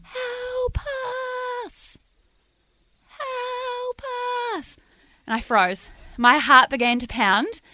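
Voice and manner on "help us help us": high, soft, drawn-out wail; eerie distant cry. Retold or imitated. Mysterious noise heard in a dark campsite, eerie faint cry. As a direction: cry for help faintly from far away, stretched out high. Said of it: "I heard this noise".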